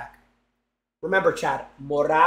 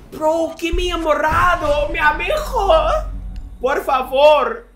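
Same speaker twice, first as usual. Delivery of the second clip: begging voice; 10 semitones higher, 5 dB louder than usual. Pretend. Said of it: "begging in a put-on voice".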